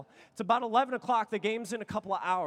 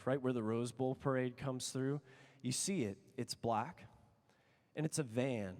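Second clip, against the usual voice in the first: hushed suspense; 9 semitones lower, 5 dB quieter than usual. Real, not acted. Describing the band's van arriving, hushed and low. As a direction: drop the voice to build suspense.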